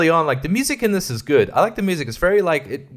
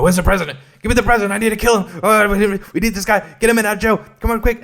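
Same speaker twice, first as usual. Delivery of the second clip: high, loud urgent shouting; panicked action-movie urgency, words tumbling out; frantic rush; 8 semitones higher, 5 dB louder, faster than usual. Acted. Everyone hunting the president in a hectic situation, frantic. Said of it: "hectic, rushed situation".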